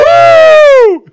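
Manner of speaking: very high, loud woo; celebratory whoop. Real, not acted. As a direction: let out a long piercing whoop. Celebrating episode two hundred.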